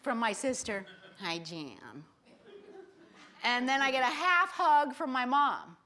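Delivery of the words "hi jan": low, quiet mock hello; cold greeting. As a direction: greet a sister with as little warmth as possible. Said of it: "this cold greeting".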